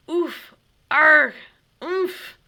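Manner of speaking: high, loud hammed-up grunts; play-dead kid groaning; mock dying groans. A narrator's reading. Timothy hit in the game, mock dying groans.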